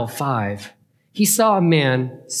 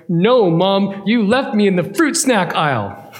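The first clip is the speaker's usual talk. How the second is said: put-on higher, louder child's complaint with a laugh; indignant boy; 6 semitones higher, 4 dB louder than usual. Boy complaining to his mom, indignant. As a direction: whine at mom like a wronged kid.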